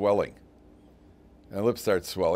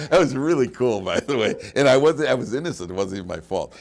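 ordinary talk, nothing marked

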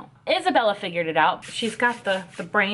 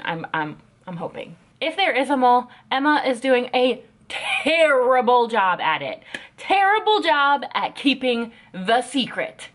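ordinary delivery

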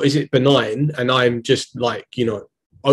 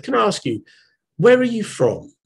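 lady's polite question; 9 semitones higher than usual. Retold or imitated. Older lady asks his origin.

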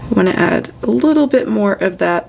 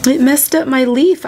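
higher, louder cry of dismay; real dismay; 6 semitones higher, 4 dB louder than usual. Real, not acted. Colorist to viewers on finding a flaw, dismayed. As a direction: blurt it out as you spot the damage.

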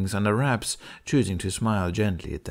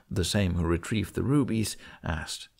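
usual speaking voice

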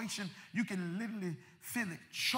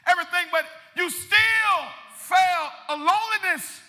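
impassioned preacher's cry; preaching at full force; 7 semitones higher, 8 dB louder than usual. Real, not acted. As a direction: drive each phrase out to the congregation.

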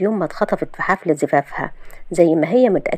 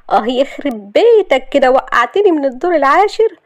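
high, indignant yelp of protest; pained complaint; 7 semitones higher, 4 dB louder than usual. Acted. Shahd cursing Yousef for dropping her, pained. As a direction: complain loudly and high after a fall.

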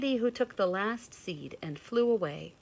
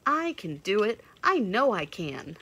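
eager fawn; 3 semitones higher, 7 dB louder than usual. A narrator's reading. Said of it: "volunteered the fawn".

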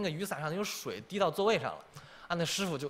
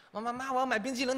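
put-on whiny kid; 8 semitones higher than usual. 孩子缠着妈妈.

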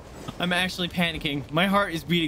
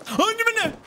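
high, louder panicked pleas; 4 dB louder than usual. Real, not acted. Gamer pleading as the boss attacks, high panic. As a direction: beg as it closes in.

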